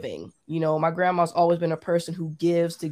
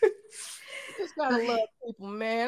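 high, soft, teary; real crying; choked up; 4 semitones higher, 5 dB quieter, slower than usual. Real, not acted. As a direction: hold back tears. Moved after friend's tears.

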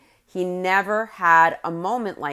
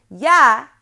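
higher, louder, mock-hesitant reply; imitated high-pitched yeah; 5 semitones higher, 7 dB louder than usual. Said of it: "hesitantly in a very high-pitched voice".

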